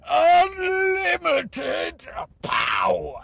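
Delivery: high, drawn-out villain shout; hammed-up evil emperor bellow, every word stretched; loud power-mad tyrant impression; Emperor Palpatine. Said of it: "just like Emperor Palpatine being assistant manager".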